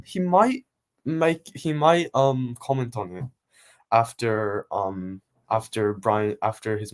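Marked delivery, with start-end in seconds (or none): none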